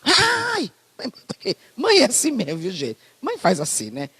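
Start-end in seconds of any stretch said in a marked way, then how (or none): 0.0-0.7 high mock cry of "Ai!"; a sharp high imitated yelp. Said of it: "Eu já vi a mãe dele dando uns".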